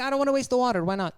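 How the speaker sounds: high excited. Retold or imitated. Retelling her own reply.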